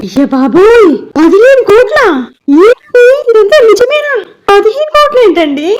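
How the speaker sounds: high, stunned gasp at the sum; can't believe the number she just heard; shocked disbelief. Acted. Wife stunned by the fifteen crore debt, shocked disbelief.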